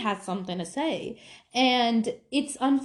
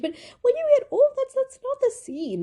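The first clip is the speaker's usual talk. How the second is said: sudden high emphasis. Real, not acted.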